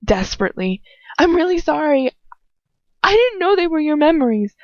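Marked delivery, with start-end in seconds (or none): 1.2-4.5 much higher, frightened pleading; desperate apology. Acted. Terrified girl pleading with her furious captor, desperate. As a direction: beg him to believe you. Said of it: "I sputter desperately".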